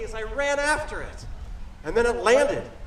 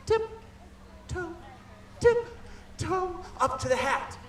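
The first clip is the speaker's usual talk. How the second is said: sneaky tiptoe; 3 semitones higher, 8 dB quieter, much slower than usual. Acted. Sneaking up on a bear.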